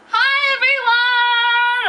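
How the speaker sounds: loud sung-out greeting; yelling. Pretend. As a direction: belt it out, high and drawn out. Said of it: "I'm yelling".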